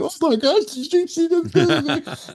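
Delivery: shocked writer reading a cruel editor's note, high and slow; stunned disbelief. Retold or imitated. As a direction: gasp it out like you can't believe what you just read. Shocked on reading harsh notes.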